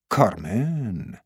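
soft, slow, drawn-out CARMEN; musing. A narrator's reading. Man hears the woman's name at last, musing softly. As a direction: let کارمن out slowly and softly, falling.

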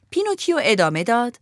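ordinary delivery

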